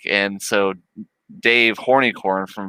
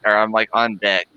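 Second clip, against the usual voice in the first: ordinary delivery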